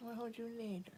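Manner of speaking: "I'll hold you later" whispered soft and high. Real, not acted. Promising her cat cuddles later, whispered "I'll hold you later".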